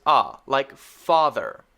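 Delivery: a said as open ah, father stretched slowly. Shown as a demonstration. Teacher showing second short a sound. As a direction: model the ah in father. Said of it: "A has another sound".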